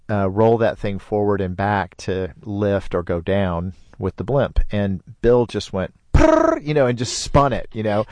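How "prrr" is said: high, buzzing lip trill; a quick playful whirr for the wheel spinning; imitated whirring spin. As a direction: make the sound of a wheel spun hard. Friend spinning the blimp wheel.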